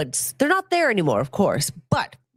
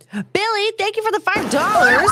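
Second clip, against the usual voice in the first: high, rising thank-you shout; excited shout-out; 12 semitones higher, 3 dB louder than usual. Real, not acted. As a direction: call out thanks big and high, getting louder. Calls out thanks for a donation.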